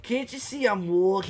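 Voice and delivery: broad mock New York voice; New York accent. Friend echoing the famous line, mock New York accent. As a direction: do a hammed-up New Yorker. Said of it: "like, New York does".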